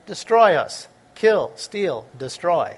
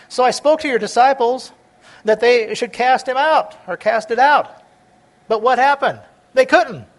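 pleading father; 5 semitones higher, 6 dB louder than usual. Acted. Father pleads to Jesus, retold.

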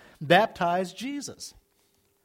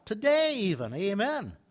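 normal talking voice

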